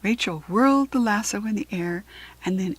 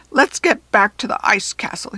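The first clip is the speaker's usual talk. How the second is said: goblin voice; 6 dB louder than usual. A narrator's reading. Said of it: "he said".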